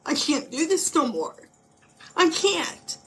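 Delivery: a touch of breaking despair; retold despair, rising. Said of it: "But I said".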